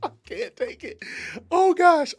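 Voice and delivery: high, breathless laughing squeak; breaking up laughing. Real, not acted. Host cracking up at Candace, laughing helplessly. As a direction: lose it completely, barely getting words out. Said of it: "Stop, man".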